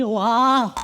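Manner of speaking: usual speaking voice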